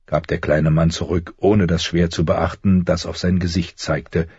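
ordinary delivery, nothing marked

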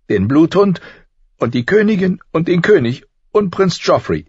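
put-on high, loud girl's voice, worked up; young Arya pouring out her anger; upset girl. A narrator's reading. (aufgebrachtes Mädchen, hoch und laut)

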